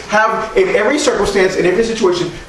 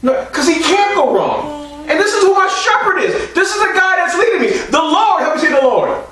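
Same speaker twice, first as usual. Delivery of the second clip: higher, shouted call to the church; full-force preaching; 9 semitones higher than usual. Acted. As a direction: lift the room with it.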